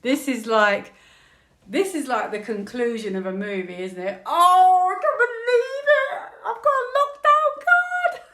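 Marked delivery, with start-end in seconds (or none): 4.2-8.3 high, loud excited shriek ending in a laugh; screaming. Real, not acted. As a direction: shriek with disbelief at the card. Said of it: "I don't know why I'm screaming".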